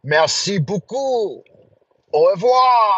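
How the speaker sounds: playful French pronunciation; French accent. Shown as a demonstration. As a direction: show off a mock French accent. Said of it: "I know".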